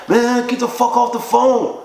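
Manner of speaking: high-pitched imitation of an angry brush-off; retold angry dismissal. Upset friend ordering caller off the phone, retold angry. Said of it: "He sound like that".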